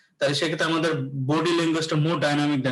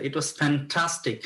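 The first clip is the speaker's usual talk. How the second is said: "fantastic" heavily stretched for excitement; exciting, enthusiastic; much slower than usual. Shown as a demonstration. Teacher showing students how to sound excited, enthusiastic. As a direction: draw out "fantastic" with relish, falling at the end. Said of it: "এটা একটু exciting".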